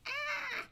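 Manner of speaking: small high-pitched squeal; high, thin mock scream. Teacher reacting to fifty thousand people, mock squeal. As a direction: squeal softly in mock alarm.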